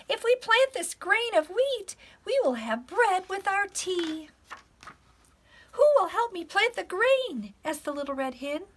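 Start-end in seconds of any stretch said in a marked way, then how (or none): 0.1-7.5 high, loud storybook hen voice; squawking hen. A narrator's reading. Little Red Hen asking the animals to help plant, squawking. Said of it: "she squawked to the other animals".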